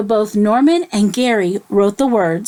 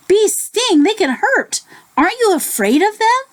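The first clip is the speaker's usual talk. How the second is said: high raccoon voice; 10 semitones higher than usual. Acted.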